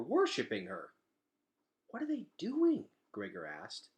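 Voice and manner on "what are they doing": surprised. A narrator's reading. Gregor alarmed, watching roaches.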